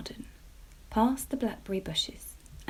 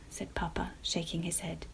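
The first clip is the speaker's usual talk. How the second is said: plain reading voice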